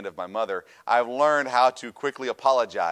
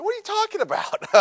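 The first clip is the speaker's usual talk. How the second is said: indignant, much higher and louder protest; offended disbelief; 4 dB louder than usual. Retold or imitated. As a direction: shoot up in outraged disbelief. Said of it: "I was offended".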